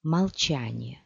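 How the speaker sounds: plain reading voice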